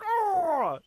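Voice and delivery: high, drawn-out mock groan; bumbling idiot groan. Mocking the killer's stun noise, bumbling groan. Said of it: "sound like a bumbling idiot".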